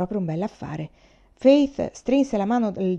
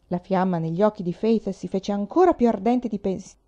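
plain reading voice

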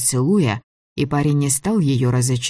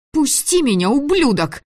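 much higher desperate yell; struggling against restraints; 12 semitones higher than usual. A narrator's reading. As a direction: scream while fighting free. Она пыталась вырваться.

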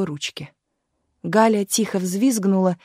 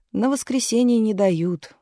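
ordinary delivery, nothing marked

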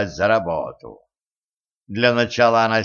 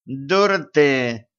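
haughty; 4 semitones higher, much slower than usual. A narrator's reading. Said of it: "высокомерно бросила ведьма".

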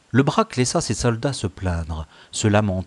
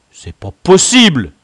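loud, high roar of disbelief; 8 semitones higher, 12 dB louder than usual. A narrator's reading. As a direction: roar it out in outrage. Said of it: "rugit-il". (rugir d'incrédulité)

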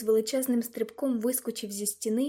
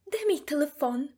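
higher-pitched irritated question; irate madam's put-on voice; indignant demand; 5 semitones higher than usual. A narrator's reading. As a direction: demand it in a huff. Angry madam demands her phone.

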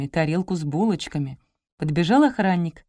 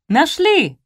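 loud treble shout of discovery; high-pitched cry; 10 semitones higher, 7 dB louder than usual. A narrator's reading. Salesperson announces she is found, high-pitched cry. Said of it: "закричал Дисконт".

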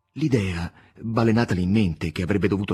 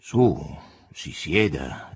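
commanding tone; 4 semitones lower, much slower than usual. Acted. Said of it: "in tono di comando".

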